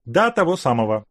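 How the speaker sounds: broad grin. Acted. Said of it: "Ещё более широко улыбнулся Савенков".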